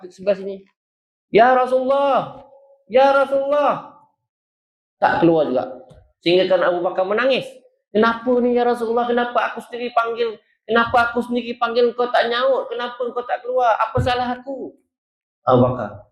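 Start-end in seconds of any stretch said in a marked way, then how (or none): 1.3-4.0 high, loud, slow cry; slow calling out. Acted. Abu Bakar calling at the window. 7.9-14.7 higher, pleading questions; hurt pleading. Acted. Abu Bakar pleading, hurt.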